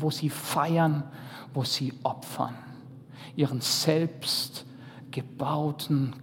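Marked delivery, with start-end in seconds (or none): none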